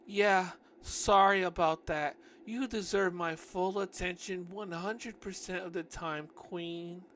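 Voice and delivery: slow, high put-on sweet talk; fawning apology. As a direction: lay on the devotion, drawn out. Acted fawning apology to Mia.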